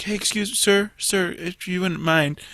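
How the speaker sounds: meek politeness. Retold or imitated. Imitating someone speaking up to the slow customer, meekly polite. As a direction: drop to a small, deferential voice. Same person turns suddenly polite.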